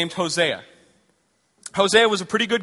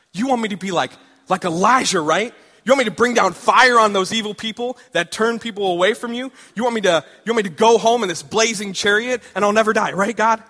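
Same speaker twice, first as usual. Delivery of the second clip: higher and louder, eager rush; excited Hosea; 4 semitones higher, 6 dB louder than usual. Acted. Hosea pitching dreams to God, loud and eager.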